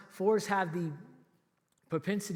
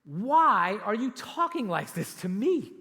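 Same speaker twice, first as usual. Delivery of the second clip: slow and louder, stressing why; pleading question; 4 semitones higher, 8 dB louder, slower than usual. Retold or imitated. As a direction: plead it slowly, bewildered. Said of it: "And then he says this".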